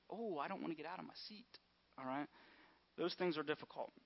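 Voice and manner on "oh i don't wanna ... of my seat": reluctant whine. Retold or imitated. Reluctant congregant voiced.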